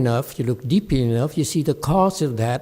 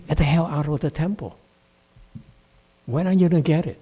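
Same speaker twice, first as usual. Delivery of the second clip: ordinary talk, nothing marked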